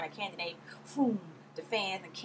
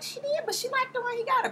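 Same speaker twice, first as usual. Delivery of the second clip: mock reply; 4 dB louder than usual. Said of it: "And I was like".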